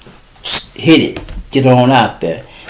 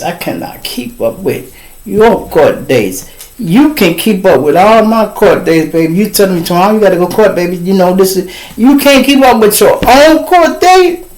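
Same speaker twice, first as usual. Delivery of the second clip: exasperated rant; 8 semitones higher than usual. Retold or imitated. Man scolding his wife about her court dates, rising exasperated rant. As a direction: let the frustration climb to a high yell.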